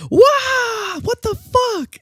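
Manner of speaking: very high, loud shocked screaming cry. Retold or imitated. Girlfriend seeing the purple toilet, screaming shock. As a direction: scream it from the bathroom in horror. I hear.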